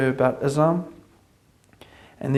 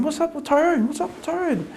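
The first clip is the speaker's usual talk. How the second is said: high, curious mock coworker voice; high-voiced coworkers wondering aloud; puzzled coworkers; 12 semitones higher than usual. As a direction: imitate the puzzled office chatter. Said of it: "Everyone was going".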